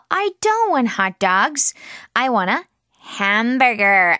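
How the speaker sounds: very slow, clear model line; slow model sentence. Shown as a demonstration. Teacher modelling sentence slowly for children. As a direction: say it slowly so kids can repeat. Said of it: "model sentence for repetition".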